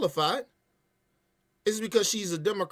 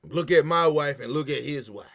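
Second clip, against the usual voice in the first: normal talking voice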